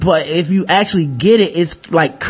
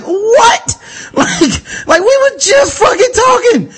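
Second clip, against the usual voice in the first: outraged disbelief; 13 semitones higher, slower than usual. Retold or imitated. Server stunned at the manager, high outraged cry. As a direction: shriek it in mock outrage. Outraged reaction in retold story.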